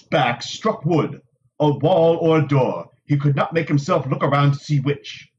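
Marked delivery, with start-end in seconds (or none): none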